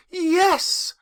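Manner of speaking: put-on high, loud and bubbling little girl; gleeful child. Little Fan gleefully telling her brother he's going home. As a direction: burst in full of joy for her brother. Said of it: "brimful of glee".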